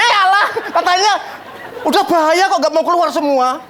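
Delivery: high, laughing mock retort; a lightly voiced sulky woman. Embarrassed woman snaps back. (balasan ketus yang ditirukan sambil tertawa)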